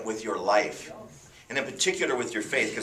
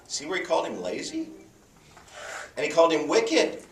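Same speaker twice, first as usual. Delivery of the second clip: normal talking voice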